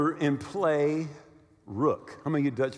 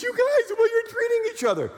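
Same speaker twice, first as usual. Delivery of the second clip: very high-pitched imitation of crying; mock tearful wail; 3 dB louder than usual. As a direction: wail it high, like a hammed-up weeper. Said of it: "she literally starts to cry".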